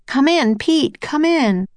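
high, urgent calling; radio call. A narrator's reading. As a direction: call out into the radio, drawing out the end. Nancy calling on small radio.

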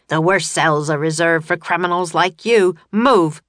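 loud threat building to a shouted order; bully them, then shout the last word; grouchy guard. A narrator's reading. Grouchy guard to captured criminals, loud and grouchy. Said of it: "said the grouchy guard".

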